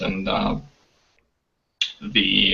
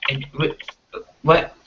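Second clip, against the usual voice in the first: stuttering guard; 5 semitones higher than usual. Acted. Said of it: "he's just stuttering over himself".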